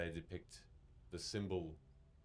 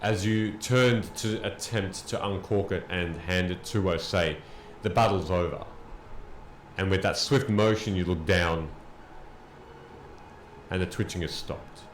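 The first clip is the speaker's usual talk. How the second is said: slow, loud, weighty storytelling; dramatic narration; 9 dB louder, slower than usual. Acted. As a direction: narrate the fall, slow and heavy. GM narrates the battle's end.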